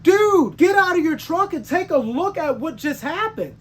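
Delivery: yelling at the other driver, pitch jumping up; angry shout. Retold or imitated. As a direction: bark it out, furious and loud. Angry trucker confronting other driver.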